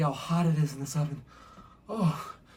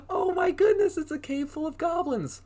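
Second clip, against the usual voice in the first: much higher, wide-eyed exclamation; amazed. Acted. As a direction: gasp it out like you can't believe it. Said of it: "amazed reaction".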